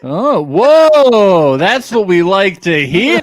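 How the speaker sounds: big loud approval; loud cheering. Real, not acted. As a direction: cheer the news like a rowdy crowd. Cheering news that they are married.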